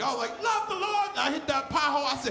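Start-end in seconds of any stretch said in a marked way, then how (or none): 0.4-1.1 high-pitched pious praise exclamation; gospel praise. Acted. Driver enjoying gospel music in the car, high devout praise. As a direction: praise the Lord along with gospel radio. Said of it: "I was listening to gospel music".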